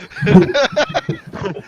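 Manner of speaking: shrill burst of laughter; high squealing laugh. Real, not acted. GM cracking up at the table, high laugh.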